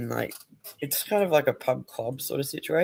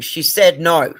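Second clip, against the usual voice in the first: fierce retold yell; 3 semitones higher, 8 dB louder, slower than usual. Daughter holding harasser by throat.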